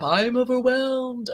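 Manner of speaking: slow, drawn-out waking complaint; imitating an overwhelmed self on waking; mock overwhelmed. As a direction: drag out the self-pitying words. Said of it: "so many mornings I'd, like, before I even woke up".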